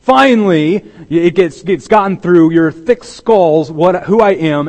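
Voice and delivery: mock the disciples' belated belief with a furious edge; exasperated sarcastic outburst at thick skulls; righteous indignation. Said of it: "an outburst of, uh, uh, and it was one of righteous indignation".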